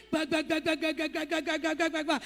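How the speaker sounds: mouth-drum the entrance beat; beatbox the wrestler's theme, steady; imitated entrance drums. Imitating Undertaker's entrance music.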